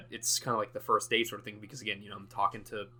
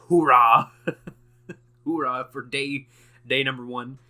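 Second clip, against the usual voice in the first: excited cheer; 4 semitones higher, 11 dB louder than usual. Pretend. Celebrating day one.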